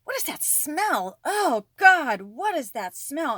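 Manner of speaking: high, slow, disgusted 'what is that smell', with a loud 'oh God'; a stranger recoiling in disgust, high and slow; disgusted woman. Retold or imitated. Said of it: "and says".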